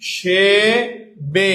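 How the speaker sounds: "হিসেবে" drawn out syllable by syllable; demonstrated pronunciation. Shown as a demonstration. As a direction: say "হিসেবে" very slowly, hi... she... be, each with a plain e. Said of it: "এটার উচ্চারণটা কি হবে".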